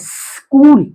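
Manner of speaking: स्कूल opened on a long 'sss' before 'kool'; drawn-out first sound. Shown as a demonstration. Teacher shows pupils the s sound, held in स्कूल. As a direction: hold the s of स्कूल, then finish the word. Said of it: "स्कूल के लिए कैसे आवाज निकालते हैं".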